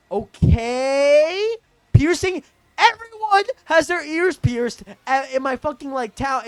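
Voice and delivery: loud, high, exaggerated disbelief; incredulous scoffing. Scoffing at friend's piercing accusation, incredulous outburst. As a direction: shoot up in pitch, exasperated and incredulous.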